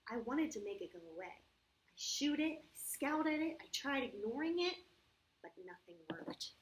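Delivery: put-on storybook narration for children; story reading. A narrator's reading. Teacher reads the problem story to the kids, storybook voice. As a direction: read it like a picture book aloud.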